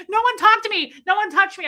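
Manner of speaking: high, loud panicked warning; mock freak-out. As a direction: ward everyone off in comic disgust. Retold running from germs.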